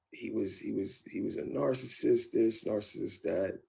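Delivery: mock her sobbing story of abuse; mimicking girlfriend. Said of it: "retold girlfriend's claims".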